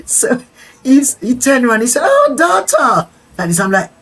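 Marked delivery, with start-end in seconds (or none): 2.0-3.1 drawn-out, high-pitched mock exclamation; acted delighted greeting. Rasta man turns round and hails the newcomer, high delighted greeting. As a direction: hail her in a high, stretched-out call.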